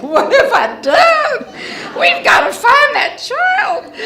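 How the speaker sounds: a mother realising what she has done, wailing; panicked wail. Retold or imitated. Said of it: "crying my eyes out".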